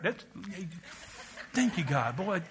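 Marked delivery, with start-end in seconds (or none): none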